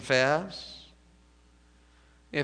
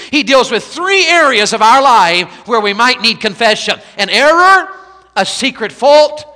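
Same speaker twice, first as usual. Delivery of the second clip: louder, higher preacher's cry over the scripture; preaching at full force; 6 semitones higher, 9 dB louder than usual. Acted. A preacher driving Psalm nineteen home to the congregation, preaching full force. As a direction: ring it out like a revival preacher.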